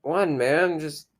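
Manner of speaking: high, loud lazy stoner impression. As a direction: play a laid-back stoner. Imitating stoner voice.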